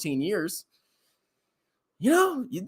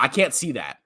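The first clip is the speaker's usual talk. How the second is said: usual speaking voice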